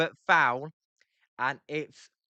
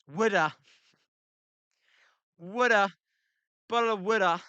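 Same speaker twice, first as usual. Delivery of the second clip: Philly wooder; 2 semitones higher than usual. Shown as a demonstration. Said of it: "pronounced it correct".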